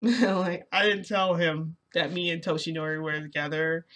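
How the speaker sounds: usual speaking voice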